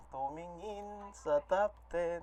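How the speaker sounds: slow, softened, stretched words; drawn-out delivery. Real, not acted. Playfully draws out phrase about looking. (mabagal at pahabang bigkas)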